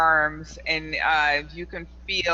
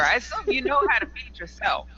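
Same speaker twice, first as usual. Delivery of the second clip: put-on old hag; 8 semitones higher than usual. Crone berates the player.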